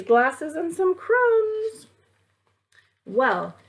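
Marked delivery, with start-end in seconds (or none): 0.0-1.9 slow, higher mock sob over the crumbs; mock crying. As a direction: wail it in pretend grief. Cookie eaten, mock dismay.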